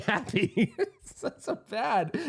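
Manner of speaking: high, laughing through the words. Real, not acted. Storyteller admitting he was mad at a happy friend, laughing. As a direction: really crack up at your own pettiness. Laughing at his own behaviour.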